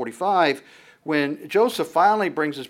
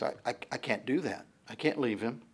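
softer, hesitant plea of Judah; soft pleading; 8 dB quieter than usual. Retold or imitated. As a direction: quietly stammer the refusal. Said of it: "And he says".

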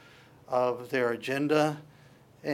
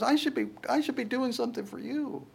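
high, pleading Peter protest; mock Peter; 9 semitones higher than usual. Pastor voicing Peter protesting the foot washing. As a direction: Peter insisting he should serve.

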